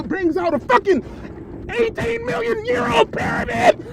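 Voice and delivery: high, strained disbelieving shout, close to a cry; outraged yelling. Real, not acted. Incredulous rant at host Sonetta.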